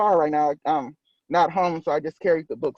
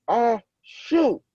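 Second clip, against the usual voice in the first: sneeze; 7 semitones higher than usual. A narrator's reading. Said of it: "When SpongeBob sneezed".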